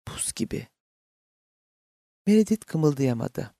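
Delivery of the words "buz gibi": very soft whisper. A narrator's reading. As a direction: barely voice it, all breath. Mother whispering beside unresponsive father. (fısıltıyla)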